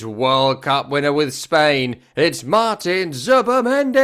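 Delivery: high announcer build-up with drawn-out name; stadium announcer. Retold or imitated. Announcing a World Cup winner to the crowd, hyped stadium announcer. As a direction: stretch the name out, rising high.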